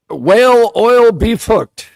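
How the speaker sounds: high, loud, slow Irish accent. Shown as a demonstration. Co-host stresses the H in Irish accent. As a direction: lean hard on the H in "Whale". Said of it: "putting extra emphasis on the H".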